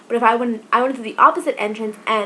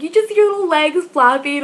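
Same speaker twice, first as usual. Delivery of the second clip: a higher, louder imitated friend; laughing friend; 7 semitones higher, 5 dB louder than usual. Friend describing her trash-can roll, mock gleeful voice. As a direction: voice the friend who is cracking up.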